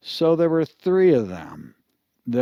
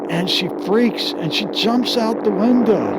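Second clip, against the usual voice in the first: high, louder narration of sudden panic; excited storytelling; 9 semitones higher, 4 dB louder than usual. A narrator's reading. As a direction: build the story's frantic climax, raised in pitch. Storyteller acts up the girl's panic.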